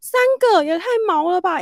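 high, loud creeped-out cry; spooked exclamation. Retold or imitated. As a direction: recoil at how creepy three ghosts is. Overheard the call, freaked out.

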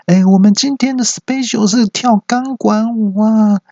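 very high, slow hostess announcement; high-pitched club announcer; hostess on microphone. Acted. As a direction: announce the special act in a high, stretched MC voice. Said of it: "那边麦克风，酒店小姐那边又传来说".

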